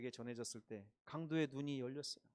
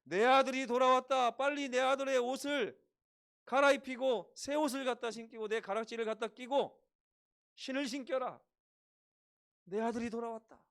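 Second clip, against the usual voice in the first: loud, higher joyful commands; father's joyful call; 7 semitones higher, 10 dB louder than usual. Acted. Father calling servants, joyful loud commands. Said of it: "종들을 불러가지고".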